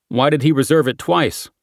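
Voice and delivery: ordinary delivery, nothing marked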